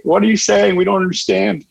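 a hint of a bored child calling out; imitating confused kids. Kids heckling the speaker, mild mock puzzlement.

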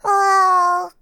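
put-on high, curious little kid asking; small child's voice. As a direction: point and ask like a toddler. (高い声で幼い子供らしく尋ねる)

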